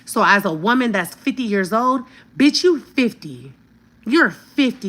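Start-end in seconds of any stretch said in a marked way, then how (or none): 2.4-4.9 drawn-out mocking jab, higher and louder; mocking taunt. Real, not acted. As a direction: taunt her age with scorn. Roasting the sister mockingly.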